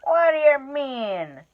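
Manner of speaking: high, loud, slow troll demand; a troll growing suspicious. Acted.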